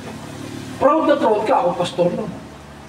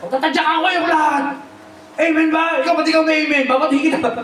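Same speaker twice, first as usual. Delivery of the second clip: mock shouting pastor; 8 semitones higher than usual. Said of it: "May mga pasigaw-sigaw pa".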